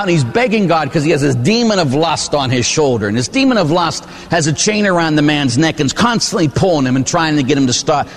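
usual speaking voice

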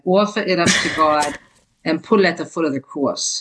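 put-on broad Jersey accent, dropped R's; mother's Jersey accent. Daughter quoting her mother's saying, Jersey accent. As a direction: say it like a Jersey mom. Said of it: "say it in my mother's Jersey accent".

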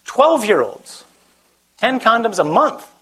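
incredulous. Real, not acted. Incredulous restating the news.

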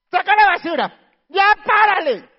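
put-on high, loud yelling at the kids; stressed parent yelling. Worn-out parent yelling at the kids, loud and high. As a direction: snap at them, fed up.

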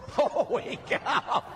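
slow, hammed-up amazement; amazed reaction. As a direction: stretch it out in comic disbelief. Astonished reaction to a huge penance.

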